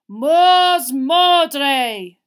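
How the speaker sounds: booming, drawn-out spell cast; incantation. A narrator's reading. Unseen caster in the wood, shouted incantation. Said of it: "uttered not a panicked shi- shout but what sounded like a spell".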